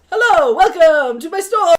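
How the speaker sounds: loud, high mock American shopkeeper voice, laughing; a British guy's hammed-up American accent. Quoting the reel's Brit playing an American clerk, mock American accent. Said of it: "and I just did that".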